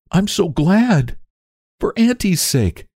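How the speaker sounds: high, slow, beaming gladness; glowing with joy. A narrator's reading. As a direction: savour the happy news. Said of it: "said Theodora with shining eyes".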